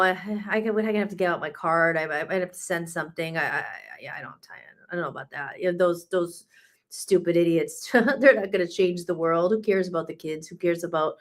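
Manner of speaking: lightly voiced callous cheapskate shrugging it off; a hint of a lazy, careless listener; mock stingy listener. Mocking listeners who won't pay.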